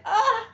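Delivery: high, sudden "ah"; mock scandalized cry; retold shocked gasp. Viewer reacting to Jane's line, mock shocked.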